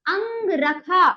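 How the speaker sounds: अंग रखा slow, with nasal अं; two measured parts, अंग then रखा; model pronunciation. Shown as a demonstration. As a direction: say अंग रखा firmly and slowly for pupils. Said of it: "बोलिए".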